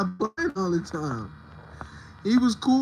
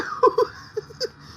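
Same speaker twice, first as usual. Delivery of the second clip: breathy high laugh. Real, not acted.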